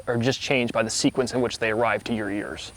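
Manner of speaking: normal talking voice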